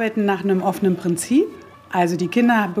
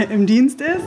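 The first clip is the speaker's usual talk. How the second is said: laughing; 4 semitones higher than usual. Real, not acted. Laughs at her own long service.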